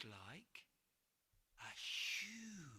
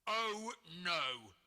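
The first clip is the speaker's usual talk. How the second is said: loud, big troll voice; booming troll; 10 dB louder than usual. Acted. Troll to George, booming denial. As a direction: boom it out like a jolly troll. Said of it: "boomed the troll".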